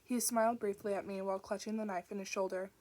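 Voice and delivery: ordinary delivery, nothing marked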